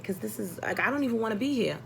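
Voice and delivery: ordinary talk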